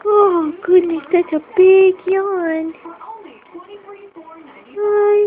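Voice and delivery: ordinary talk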